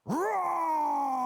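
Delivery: high loud roar with little claws; kid's roar. Retold or imitated. Five-year-old Josh roaring at his coach. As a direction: roar like a small bear. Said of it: "And he goes".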